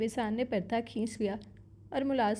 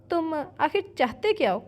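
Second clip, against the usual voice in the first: angry outburst; 6 semitones higher, 4 dB louder than usual. A narrator's reading. Angry outburst at scolding brother.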